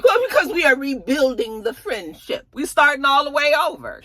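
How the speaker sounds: a high, self-righteous explanation. Retold or imitated. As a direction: explain it like it's obvious. [name] explaining the handshakes.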